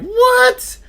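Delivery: shrieked very high and loud in disbelief; shocked what. Retold or imitated. Sailor hearing the free day was fake, shrieking. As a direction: squeal it like you can't believe it.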